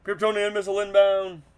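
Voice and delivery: loud announcer-style warning; loud callout. Acted. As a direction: shout it like an alarm.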